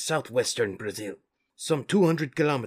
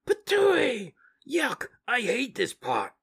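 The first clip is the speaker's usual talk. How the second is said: disgusted Mikey; 12 semitones higher, slower than usual. Acted. Mikey reacts to the goo.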